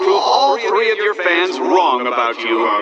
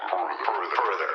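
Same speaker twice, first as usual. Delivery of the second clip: echo; 9 semitones lower, 10 dB quieter than usual. Acted. Echo of previous line.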